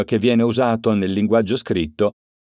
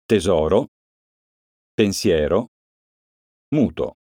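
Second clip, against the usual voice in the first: Tesoro, pensiero, muto weighted on the second-to-last syllable; penultimate stress; 3 semitones lower than usual. Shown as a demonstration. Teacher showing penultimate stress to pupils, clear stress. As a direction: lean on the next-to-last syllable. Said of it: "cade sulla penultima sillaba".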